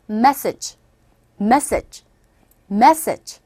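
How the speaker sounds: message with strong MESS and faint final j; English pronunciation. Shown as a demonstration. Teacher repeating three times for learners, message with weak ending. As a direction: stress the front of message, barely sound the ending. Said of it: "끝발음은 약하게".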